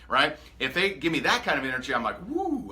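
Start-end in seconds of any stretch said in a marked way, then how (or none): 2.3-2.7 a sudden high whoop of delight; excited woo. Retold or imitated. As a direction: cheer like you just met someone fired up. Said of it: "I'm a little excited".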